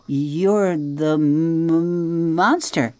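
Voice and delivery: slow, halting frightened stammer; can barely get the word out; stammering fright. Acted. Opossum stammering in fear before the robot.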